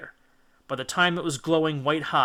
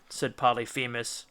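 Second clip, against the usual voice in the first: plain reading voice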